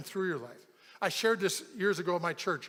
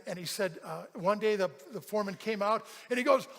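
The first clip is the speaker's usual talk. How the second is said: ordinary delivery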